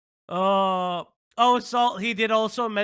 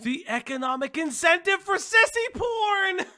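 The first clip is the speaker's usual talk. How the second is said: incredulous shout; 10 semitones higher than usual. Real, not acted. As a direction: shout it in amazed disbelief. Incredulous outburst at clip.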